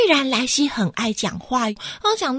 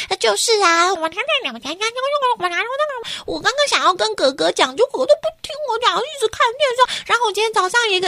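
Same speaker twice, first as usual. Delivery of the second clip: put-on high, fast kid babble; excited chatter; 9 semitones higher, faster than usual. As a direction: rattle it off eagerly.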